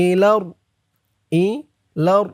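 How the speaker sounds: ordinary talk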